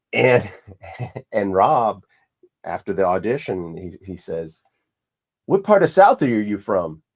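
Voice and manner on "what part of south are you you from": pitched up and loud, then dropping at the end; retold eager question. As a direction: voice a director's keen question after an audition. Director quizzes auditioning actor, retold.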